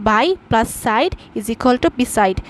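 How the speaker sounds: by plus side spoken very slowly, word by word; slow, careful classroom dictation; slow spelled out formula. Shown as a demonstration. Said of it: "slow teaching formula".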